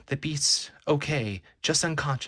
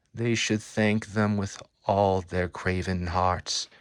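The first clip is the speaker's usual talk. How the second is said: normal talking voice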